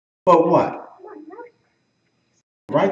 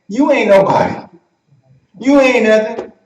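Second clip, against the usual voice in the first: louder, higher-pitched taunt; jeering dismissal; 5 semitones higher, 5 dB louder than usual. Acted. Pharisees following behind Jesus, jeering. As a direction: throw it out as a loud put-down.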